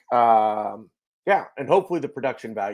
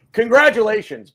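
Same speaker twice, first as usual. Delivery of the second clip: laughing congratulations; 9 semitones higher, 9 dB louder than usual. Real, not acted. Congratulating co-host on new baby.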